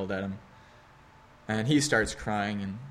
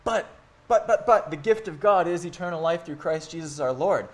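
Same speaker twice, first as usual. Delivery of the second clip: higher, louder rushed "but, but, but"; eager interjection; 4 semitones higher, 4 dB louder than usual. Retold or imitated. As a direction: jump in eagerly to correct. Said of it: "quick to jump and emphasize".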